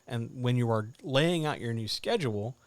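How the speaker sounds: normal talking voice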